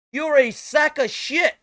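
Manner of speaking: high, loud and slow, spitting each word; furious shout. Real, not acted. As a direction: scream the insult at full force. Screaming rant at Asmongold.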